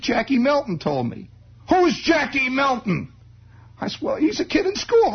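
put-on high, guileless boy's answers; innocent kid. Boy explaining to his mother who told him.

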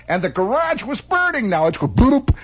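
ordinary talk

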